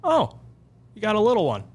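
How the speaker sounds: a casual brush-off from the Airbnb owner, voiced high; mock-breezy host; dismissive owner. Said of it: "comes out and goes".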